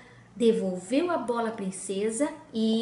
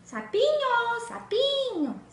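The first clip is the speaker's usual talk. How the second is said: high, sing-song call for the frog; calling sweetly; 11 semitones higher, slower than usual. Acted. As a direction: coax the little frog to come out. Princess calls the frog.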